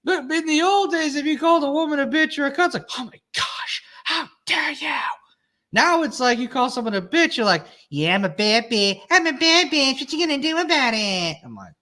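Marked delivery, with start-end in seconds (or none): 3.0-4.9 high breathy gasp of scandalized outrage; mock offended lady. Imitating an old-fashioned woman insulted by a swear, high gasping outrage. 7.9-9.0 mock sassy woman. Modern woman embraces insult. 9.1-11.4 mock sassy woman. Imitating a modern woman taunting someone who called her a name, high sassy boast.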